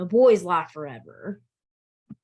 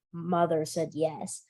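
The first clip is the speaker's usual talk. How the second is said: plain reading voice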